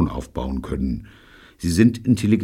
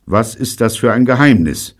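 ungeduldig; 4 semitones higher, 8 dB louder than usual. A narrator's reading. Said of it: "fragte Neil ungeduldig".

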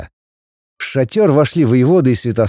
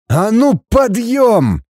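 high-pitched, angry order; yelled command; 10 semitones higher, much slower than usual. A narrator's reading. Father yelling at his defiant son, commanding. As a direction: order him up in outrage. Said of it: "заорал родитель".

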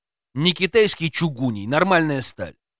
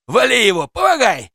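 high, loud yell in a fight; urgent shouting; 8 semitones higher, 5 dB louder than usual. A narrator's reading. Policeman calling for help in a scuffle, urgent shouting. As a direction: shout for backup, rising in pitch. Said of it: "закричал полицейский".

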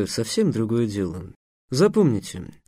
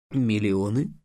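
lower, softer, stunned question; taken aback; 4 semitones lower, 5 dB quieter, slower than usual. A narrator's reading. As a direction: echo it, dumbfounded. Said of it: "оторопел Эам".

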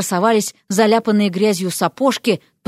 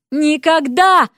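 higher, drawn-out interjection; emphatic refusal; 5 semitones higher, 3 dB louder, much slower than usual. A narrator's reading. Newcomer interrupts Ivan's wedding plans, emphatic. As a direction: cut in with a firm veto.

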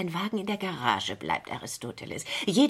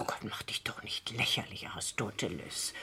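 whispered, much softer scorn; scornful whisper; 8 semitones lower, 9 dB quieter than usual. Acted. Waving off Aristoteles's threat, contemptuous whisper. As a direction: hiss it under your breath, dismissive.

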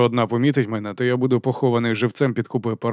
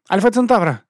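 high, loud, rattled-off answer; 9 semitones higher, 5 dB louder than usual. A narrator's reading. Abby racing to answer the teacher first, rattling it off. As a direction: blurt it out in one go. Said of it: "скоромовкою проторкотіла Еббі".

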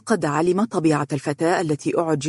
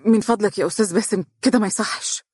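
higher-pitched, hurried flustered protest; lightly acted stammer, pitched up and fast; 5 semitones higher, faster than usual. A narrator's reading. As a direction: stumble into a quick, embarrassed objection. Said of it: "وقالت بتلعثم دون أن تنظر إليه".

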